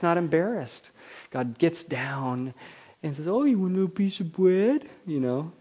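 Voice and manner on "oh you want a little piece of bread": much higher, R's turned to W's: bread as bwead; lisping baby talk. Shown as a demonstration. God offering bread to a toddler in lisping baby talk. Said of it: "'cause you can't say your R's yet".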